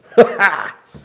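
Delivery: short, loud, high burst of laughter; a quick high cackle of a laugh; sudden laugh. Real, not acted. Exasperated at snagging gloves.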